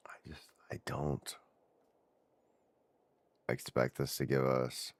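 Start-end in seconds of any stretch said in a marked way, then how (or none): 0.1-1.4 a soft whisper, trailing off. Real, not acted. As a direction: say it to yourself, barely audible. Mutters doubt under his breath.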